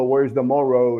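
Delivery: a touch of put-on American English accent; mocking a Puerto Rican who fakes an American accent; mock gringo accent. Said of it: "haciéndome el gringo y pronunciando". (acento de gringo fingido, en burla)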